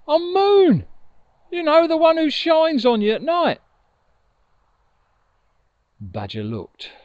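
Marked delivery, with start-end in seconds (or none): 0.1-3.6 high and loud, proud little Moon; Moon's voice. Acted. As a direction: pipe up high as the fallen Moon. Moon character voice.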